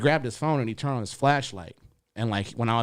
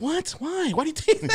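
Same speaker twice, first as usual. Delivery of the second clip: much higher, startled disbelief; surprised. Retold or imitated. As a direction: act shocked by the question. Surprised reply to DM.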